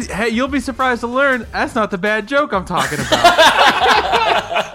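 slow, laughing through the words. Real, not acted. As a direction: hold back the giggle while teasing. Joking reply on air.

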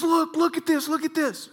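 high, loud, urgent look look; excited shout. Retold or imitated. Golfer showing friends his ball, high excited shout. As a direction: grab their attention, thrilled. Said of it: "he says".

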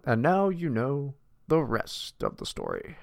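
lightly voiced slow radio sign-off; a hint of the old broadcaster, drawn out; slow mock newsman sign-off; Paul Harvey catchphrase. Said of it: "Paul Harvey was badass".

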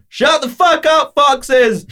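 slow, high-pitched shout at the animals outside; dad screaming. Retold or imitated. Dad at foxes out the window, screaming. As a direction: imitate dad's high, furious yell. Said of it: "I would hear my dad screaming out the window".

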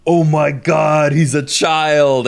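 high, slow, gasping mock outrage; shocked commenter. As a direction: drag out the horrified commenter. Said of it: "any comment where they're like".

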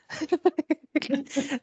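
real high-pitched laugh; helpless giggle at nonsense. Real, not acted. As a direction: burst out laughing at the absurdity. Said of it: "I'm laughing here".

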